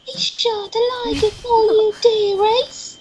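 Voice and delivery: put-on high cackly old witch. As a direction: play a creaky old witch. Kid plays witch in Minecraft.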